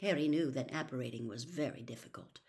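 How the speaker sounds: plain reading voice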